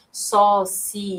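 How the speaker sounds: Sociedade slow, the E said on its own: so-ci-e-da-de; syllable by syllable. Shown as a demonstration. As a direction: pull Sociedade apart, giving the E its own beat. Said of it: "ele fica sozinho, ó".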